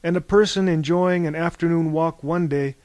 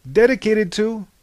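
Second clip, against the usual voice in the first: proclaiming toast; 7 semitones higher, 6 dB louder than usual. A narrator's reading.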